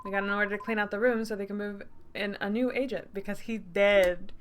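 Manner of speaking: usual speaking voice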